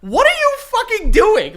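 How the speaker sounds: high, slow, incredulous yell; exasperated outburst. Pretend. Celebrity snapping at a paparazzo, high incredulous yell. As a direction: blow up at the intruder. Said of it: "And it's like".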